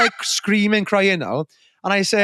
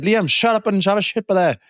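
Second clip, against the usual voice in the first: higher-pitched mock exasperation; fed up; 7 semitones higher than usual. Fed-up mate answering his drunk friend, exasperated. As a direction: imitate a mate who has had enough. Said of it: "They were probably fed up for me".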